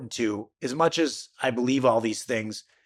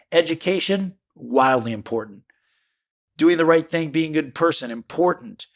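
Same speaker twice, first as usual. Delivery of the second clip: ordinary delivery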